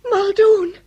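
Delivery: sudden high, loud cry of alarm. Acted. Woman hearing a familiar name cries out in alarm. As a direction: recoil at the name with a frightened cry.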